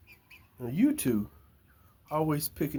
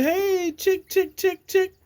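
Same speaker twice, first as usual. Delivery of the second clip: high, singsong calling of chickens; mimicking the wife's high chick-chick call; 5 dB louder, slower than usual. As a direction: imitate the wife calling the chicks. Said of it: "with a chicken voice".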